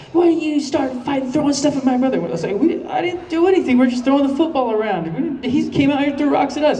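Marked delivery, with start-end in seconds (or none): 0.1-2.2 higher, mock angry girl's yell; sister yelling. Neighbour's sister scolding boys over her brother, mock yell. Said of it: "starts yelling at us". 2.6-6.8 put-on much higher, pleading boy's voice; high kid voice. Teenage him defending himself to the sister, high pleading. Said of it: "You sound like a girl".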